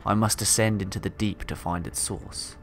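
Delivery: calm reading of a log; story narration. Acted. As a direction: read it like a captain's journal. Reads game log aloud.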